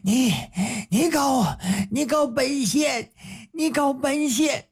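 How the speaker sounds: sputter, too angry to finish; speechless with rage. Acted. Magistrate sputtering in rage at being accused. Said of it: "气得他都说不出话来了".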